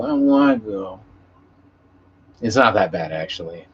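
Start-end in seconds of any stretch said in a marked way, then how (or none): 0.0-1.0 lightly voiced whiny-child protest; a hint of a kid dreading school; reluctant little kid. Adult dreading work tomorrow, mimicking a reluctant little kid. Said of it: "feel like a little kid going to school".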